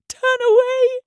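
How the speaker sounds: high, loud anguished plea; desperate wail. A narrator's reading. Weeping doctor begs Roberta to turn away, high anguished wail. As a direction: cry out in mortal agony. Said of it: "he wept".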